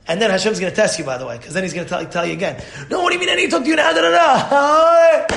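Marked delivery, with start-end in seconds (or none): 2.9-5.2 exaggerated indignant yelp; mock outburst. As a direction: blow up in a high, hammed-up huff. Acted angry retort to being spoken to.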